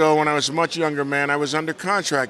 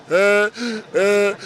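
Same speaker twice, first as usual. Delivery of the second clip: loud, high honking "uh, uh" laugh; Eddie Murphy laugh; 6 semitones higher, 4 dB louder than usual. Retold or imitated. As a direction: bray it out like Eddie's trademark laugh. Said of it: "he's got this very distinctive laugh".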